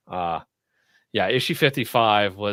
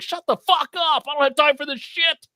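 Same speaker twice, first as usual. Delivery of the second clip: mock furious housekeeper. Housekeeper berating Gunslinger Spawn, high mock rage. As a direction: tear into him like an enraged maid.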